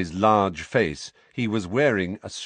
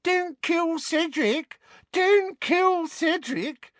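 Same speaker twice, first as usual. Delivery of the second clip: high, slow whimpering; high whimpering mimicry; slower than usual. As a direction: whimper in a shrill mocking voice. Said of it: "adopted a high-pitched whimpering voice".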